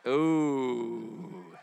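knowing, sustained "ooh"; long drawn-out ooh. Retold or imitated. Reacting to a question ripe for testimony, drawn-out ooh. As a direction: let a long ooh slide gently down and fade.

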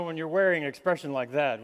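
ordinary delivery

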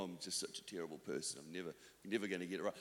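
low, quiet, dejected self-talk; mock self-pity. Preacher voicing self-condemning thoughts on waking, mock dejection. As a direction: mumble it low, as if beating yourself up.

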